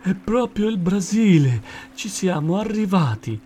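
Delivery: high, thrilled exclamation; joyful discovery. A narrator's reading. Friends washed up on beach, joyful discovery. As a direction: call it out in happy amazement. Arrival at dreamed Brazil.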